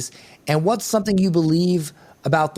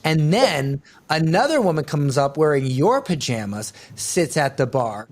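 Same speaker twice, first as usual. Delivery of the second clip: normal talking voice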